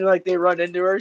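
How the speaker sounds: ordinary delivery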